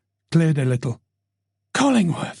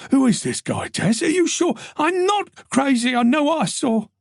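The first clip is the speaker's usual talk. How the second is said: high, tense woman's voice; rattled, defensive detective; shaken Tess; 11 semitones higher than usual. Acted. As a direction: insist you're not crazy.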